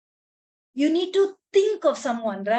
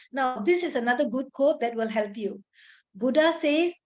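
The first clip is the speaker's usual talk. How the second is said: ordinary delivery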